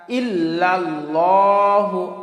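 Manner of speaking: ইল্লাল্লাহু with a thick, full lam in লাহু; slow, weighty ইল্লাল্লাহু, lam spoken heavy. Shown as a demonstration. Teacher shows the heavy lam to students. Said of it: "এখানে মোটা হবে".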